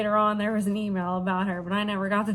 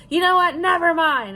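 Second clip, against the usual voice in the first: loud, high mock outburst; screaming customer; 7 semitones higher, 6 dB louder than usual. Customer storming off from the manager, loud high imitation of screaming. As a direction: imitate an angry customer giving up, raised voice. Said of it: "she just, like, screamed some more".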